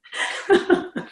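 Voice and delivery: breathy embarrassed laugh at herself. Real, not acted. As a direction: laugh it off, caught out. Laughs at own confession.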